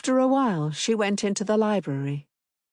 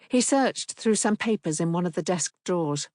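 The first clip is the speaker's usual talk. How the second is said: ordinary delivery, nothing marked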